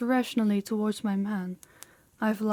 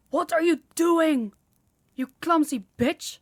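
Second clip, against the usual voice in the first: angry scolding; 7 semitones higher, 4 dB louder than usual. Acted. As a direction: scold a clumsy servant in outrage. Scolding servant who botched the drinks.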